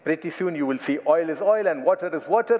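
ordinary talk, nothing marked

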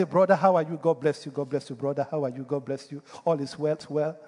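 low, softening run of greetings; murmured mock church greetings; churchgoer greetings. Acted churchgoers' greetings, deceiving themselves.

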